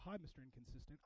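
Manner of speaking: low, muttered awkward hello; awkward greeting. Acted. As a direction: greet them quietly and uneasily. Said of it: "give you a weird side hug to go".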